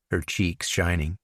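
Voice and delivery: plain reading voice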